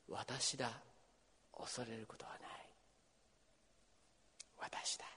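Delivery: soft breathy whisper of reassurance; hushed comforting whisper. Acted. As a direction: whisper it as if to a frightened friend. Jesus's words whispered to listeners.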